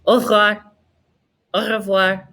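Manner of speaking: quick "Au revoir", middle r swallowed; r barely heard. Shown as a demonstration. Teacher shows the French goodbye, quick with a faint r. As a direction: run "Au revoir" together, hinting at the r. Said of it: "Sometimes you can kinda hear the r".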